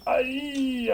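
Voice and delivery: sudden high yelp of pain; high-pitched ouch. Acted. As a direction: yelp as the iodine burns. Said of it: "I moaned quietly".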